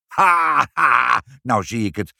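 loud high "ha!" dropping into gloating; triumphant. A narrator's reading. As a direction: crow at catching them out. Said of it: "riep meneer Pinkepank triomfantelijk".

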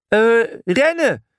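hoge, langgerekte gil; gillend. A narrator's reading. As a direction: gil het alarm uit. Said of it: "gilde Brutus". (langgerekt, hoog gegild)

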